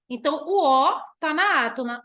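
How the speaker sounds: ordinary talk, nothing marked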